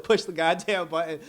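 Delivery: loud, high exasperated order; mock exasperated yell; frustrated Joker. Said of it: "I felt the frustration in Joker".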